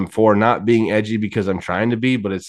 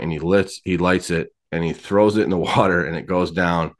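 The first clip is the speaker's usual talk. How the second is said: ordinary delivery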